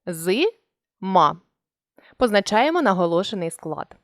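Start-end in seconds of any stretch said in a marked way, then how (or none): 0.0-1.4 З-ма split into two syllables with a short break. Shown as a demonstration. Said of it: "Далі ділимо слово на склади".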